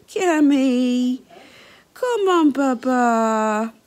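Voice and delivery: loud coaxing call; calling a dog. Real, not acted.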